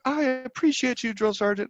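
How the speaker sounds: high timid recruit voice; sweet recruit voice. Retold or imitated. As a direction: say it like a nervous recruit. Recruit voice quoted.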